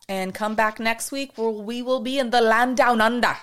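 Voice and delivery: a botched, hard-to-place attempt at an Australian accent; playful, half-failed Australian accent as the host signs off to listeners. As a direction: go for Australian and miss, landing somewhere vague. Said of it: "I thought I was gonna do a really good Australian accent".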